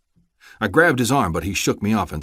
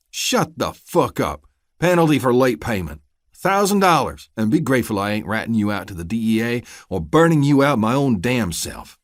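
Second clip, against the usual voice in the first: angry dismissal; 4 semitones higher, 5 dB louder than usual. Acted. Deputy silencing the farmer, contemptuous. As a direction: shut him down hard.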